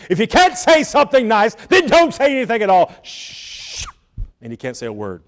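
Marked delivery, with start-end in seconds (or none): 0.1-2.9 high-pitched, firm parental scolding. Acted. A parent silencing a child, scolding emphatically. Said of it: "He would have been a great parent". 3.0-3.8 soft, drawn-out shush; hushing shh. Acted. Parent silencing a child, hushing.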